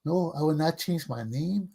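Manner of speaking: ordinary delivery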